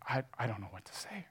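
fast, breathless whisper of disbelief; stunned whisper. Acted. Customer overwhelmed by forgiveness, hushed whisper. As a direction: whisper it quickly, lost for words.

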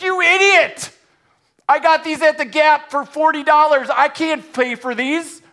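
very high, loud outraged shout; furious yell. Acted. Customer grabbing the waiter by the collar, furious yelling. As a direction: scream at him in a rage.

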